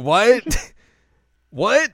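loud, high incredulous squawk; mock disbelief. Azusa baffled by the teacher wanting tea, incredulous.

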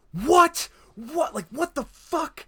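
high, loud shocked disbelief. Retold or imitated. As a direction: blurt it out, stunned. Bewildered first viewing, retold.

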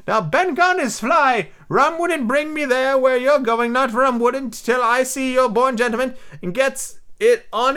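put-on high, excitable old castaway; squeaky marooned sailor, eager and odd; lightly cracked high pirate voice; Ben Gunn voice. Ben Gunn's voice in the story.

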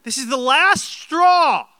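high, loud mock rant at a loved one; exasperated outburst. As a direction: yell it, hammed-up, at the end of your rope.

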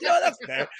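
high, laughing through the words; conceding the point with a laugh; laughing concession. Retold or imitated. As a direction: give in while cracking up. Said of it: "I was like".

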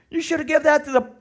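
loud, high, rushed objection; mock protest. Onlookers scolding over the perfume, mock protest.

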